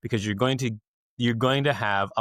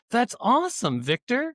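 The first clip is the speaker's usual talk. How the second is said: friend's delight; 14 semitones higher than usual. Retold or imitated. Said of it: "And then Karen goes".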